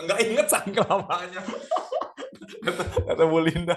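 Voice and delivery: talking through real laughter; laughing. Real, not acted. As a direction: laugh while you speak. Laughs at own admission. (sambil tertawa lepas)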